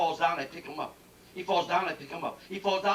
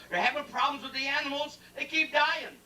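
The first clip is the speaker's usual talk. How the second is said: usual speaking voice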